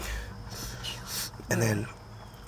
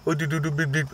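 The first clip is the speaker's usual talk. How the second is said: higher gibberish posing as Italian; mock Italian; 4 semitones higher than usual. Mocking how Italians talk, nonsense Italian babble. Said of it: "over in Italy".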